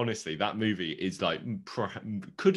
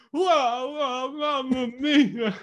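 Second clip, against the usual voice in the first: high, slow hammed-up Italian accent; 7 dB louder, much slower than usual. Retelling a bad over-the-top Italian accent impression. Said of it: "I full on went".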